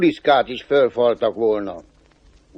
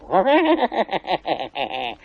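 high rattling devil laugh; devil's chuckle; 4 semitones higher, 3 dB quieter than usual. Acted. Devil reading the cobbler's boastful sign, amused chuckle.

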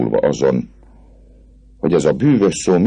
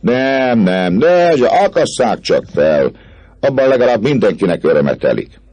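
higher, louder mocking refusal; jeering vagabond women; 9 semitones higher, 4 dB louder than usual. A narrator's reading. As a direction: laugh him off to the gallows. Women jeer answers. (gúnyos csavargónők)

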